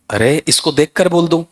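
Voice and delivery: usual speaking voice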